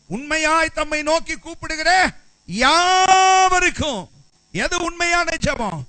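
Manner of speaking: high, loud proclaiming preacher; preaching at full force. Real, not acted. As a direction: ring out the verse, stretching the last word slow.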